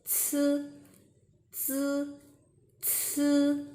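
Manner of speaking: "zi" like the ts ending cats, with a puff; high, level "zi" with an airy ts; word-ending ts sound. Shown as a demonstration. Teacher modelling from cats, aspirated "zi". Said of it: "almost the same with the ending sound of".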